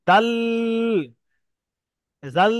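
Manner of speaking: ডাল and জাল said the mistaken way, tongue pressed up; imitating a student's wrong ডাল; wrong pronunciation demo. Shown as a demonstration. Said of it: "অনেক ভাই পড়ে ফেলে ... এইভাবে পড়ে".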